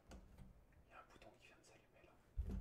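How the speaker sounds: barely audible whispered aside; hushed. Real, not acted. Streamer noticing a light come on, whispering. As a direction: whisper it almost to yourself.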